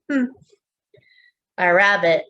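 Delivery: ordinary talk, nothing marked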